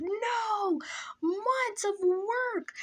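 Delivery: high-pitched drawn-out mock wail; retold devastation. Teller reliving her ruined plan, devastated mock wail. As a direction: wail it like a hammed-up tragedy. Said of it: "Like, I was devastated".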